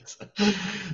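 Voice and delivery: short high chuckle; light laugh. Real, not acted. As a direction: laugh at your own joke. Laugh after joke.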